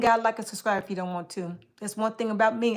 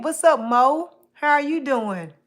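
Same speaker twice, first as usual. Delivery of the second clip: loud greeting; 4 semitones higher, 8 dB louder than usual. Real, not acted. Greeting viewer loudly.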